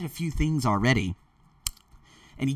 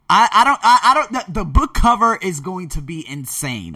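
excited; 6 semitones higher, 9 dB louder than usual. Real, not acted. Bursting about his book cover.

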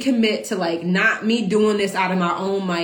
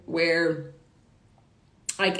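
ordinary talk

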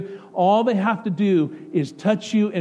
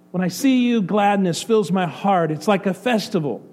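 higher and louder, gladness slowed and savoured; joyful peak; 3 semitones higher, 4 dB louder than usual. Acted. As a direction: burst out like a festival. God's joy at its peak.

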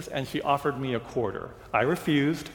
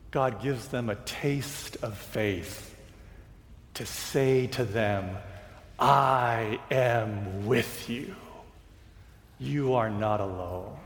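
slow, soft preaching; sermon; 4 semitones lower, 3 dB quieter, slower than usual. Acted. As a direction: lay each phrase down gently.

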